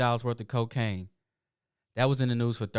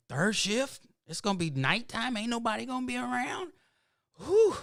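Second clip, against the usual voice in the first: high-pitched worried disbelief; mock alarm; 12 semitones higher than usual. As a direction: squeal the questions in comic dread, ending on a high whew. Acted reaction to risky night job.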